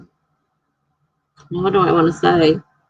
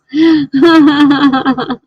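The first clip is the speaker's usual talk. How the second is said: high laugh close to tears; crying with laughter; 8 semitones higher, 5 dB louder than usual. Real, not acted. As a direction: laugh till it squeaks. Laughs at chat joke.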